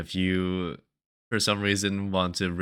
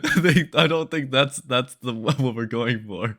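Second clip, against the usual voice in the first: talking through laughter; laughing; 6 semitones higher, 9 dB louder than usual. Real, not acted. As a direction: giggle through the words.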